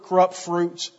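normal talking voice

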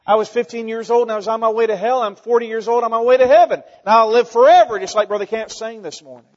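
ordinary talk, nothing marked

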